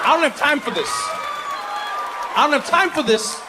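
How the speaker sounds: squeaky fed-up protest; high-pitched exasperation. Acted. Exasperated refusal, repeated for laughs.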